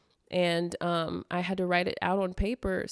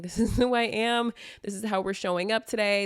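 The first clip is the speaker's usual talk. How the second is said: "this is who I am" higher and louder, punchy; loud, proud "this is who I am"; 4 semitones higher, 4 dB louder than usual. Retold or imitated. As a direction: proclaim "this is who I am" with pride. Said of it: "And I'm like".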